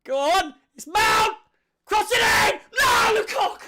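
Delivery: shouting at the match. Real, not acted. Live chance in the match.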